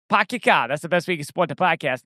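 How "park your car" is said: "Park your car" with the r's dropped, "Pahk ya cah"; hammed-up Boston "Park your car"; Boston accent. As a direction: say it like a Boston local. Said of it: "do the outro in a Boston accent".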